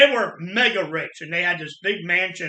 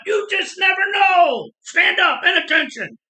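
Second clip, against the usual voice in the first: much higher, louder barked command; drill shout; 12 semitones higher, 8 dB louder than usual. Retold or imitated. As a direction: bark it like a Marine drill sergeant. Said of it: "And he's like".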